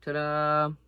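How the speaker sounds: proud little reveal; triumphant. Real, not acted. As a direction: present it like a magic trick. Screw finally extracted.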